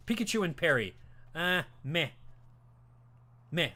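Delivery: normal talking voice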